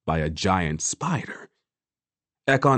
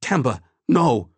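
alarmed protest; 5 semitones higher, 4 dB louder than usual. A narrator's reading. Ekon protests Temba's offer.

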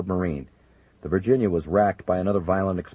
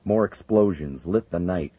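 ordinary delivery, nothing marked

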